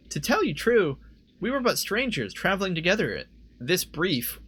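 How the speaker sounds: high, formal heroine voice; lilting high character voice. Acted. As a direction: pitch it up and proclaim it archly. Reads character's reply in voice.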